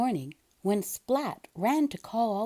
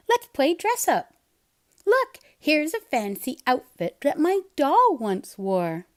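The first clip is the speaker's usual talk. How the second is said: put-on higher, louder girlish eagerness; Kitten's voice; 5 semitones higher, 5 dB louder than usual. Kitten dressing up Seymour, eager. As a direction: play bossy little hostess.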